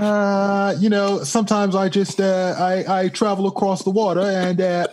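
bad English accent. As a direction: keep the mock British toff voice going, then falter. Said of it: "That's bad English accent".

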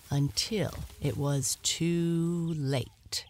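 ordinary delivery

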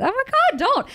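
high, loud burst of real excitement; excited protest. Real, not acted. Guest urging the teary host not to cry, excited and high. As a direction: squeal it out, begging her not to cry.

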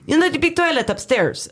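a high put-on voice for the boyfriend; mock high boyfriend voice. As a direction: pitch it way up to mimic him. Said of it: "And he's like".

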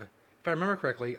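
normal talking voice

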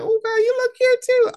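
very high gushing reply; squealed compliment. Retold or imitated. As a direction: squeal the compliment right back, playfully. Retold return of the compliment.

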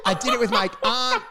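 ordinary talk, nothing marked

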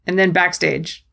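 ordinary talk

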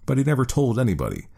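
usual speaking voice

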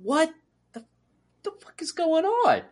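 high-pitched mock disbelief; baffled viewer reacting to the show; exasperated disbelief. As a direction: ask it high and incredulous. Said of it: "And I'm like".

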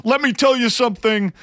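high, loud wrestler catchphrase; loud wrestling promo. Retold or imitated. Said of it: "in a loud voice".

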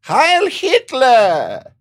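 high, loud, drawn-out mock cry; drunken shout. Old bar drunk turning round, mock drunken shout. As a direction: imitate a drunk bellowing with long vowels. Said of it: "turned around all drunk and went".

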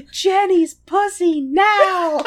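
very high, loud, drawn-out squawk; silly parody voice. Acted. Host riffing on the lovers for laughs, loud high parody voice. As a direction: stretch it out in a ridiculous high voice.

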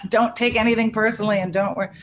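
quoting the warning while laughing, pitch lifted; laughing through the words. Real, not acted. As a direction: laugh as you repeat the advice. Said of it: "I just laughing 'cause I'd been warned".

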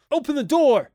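loud shout at a very high pitch; crying out. A narrator's reading. Father trying the knob, crying out. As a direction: cry out urgently to be let out. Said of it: "cried George Hadley".

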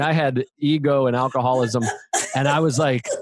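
normal talking voice

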